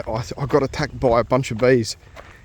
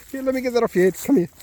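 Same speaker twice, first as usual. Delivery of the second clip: much higher, sing-song pet voice; 11 semitones higher, faster than usual. Real, not acted. Owner cooing at his dog Shadow in baby talk.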